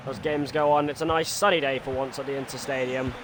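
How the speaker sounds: live football commentator voice; excited stadium commentary; play-by-play commentary. Acted. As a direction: call the match like a TV pundit.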